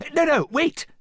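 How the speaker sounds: high, loud plea; panicked prince crying wait. A narrator's reading. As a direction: blurt it out fast to stop them. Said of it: "cried Charming".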